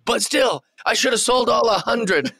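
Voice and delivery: high mock yell, jumping back into the rant; Kevin Owens. Wrestler resuming his merch complaint after an aside, mock yelling. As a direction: imitate an indignant wrestler going off. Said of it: "when he's just yelling about how".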